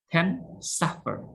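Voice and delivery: suffer with SUF stressed. Shown as a demonstration. Teacher drilling vocabulary, stress on suf. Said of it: "stress on suffer".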